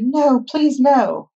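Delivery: high, frightened pleading shout. A narrator's reading. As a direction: cry out to fend off danger. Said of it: "Louis shouted".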